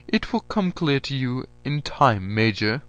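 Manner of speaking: a general brushing off a question; lightly voiced stern general. A narrator's reading. General evading the major's question.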